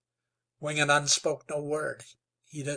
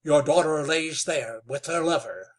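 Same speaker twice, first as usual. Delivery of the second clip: firm; 7 dB louder than usual. Acted. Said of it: "said Wetzel firmly".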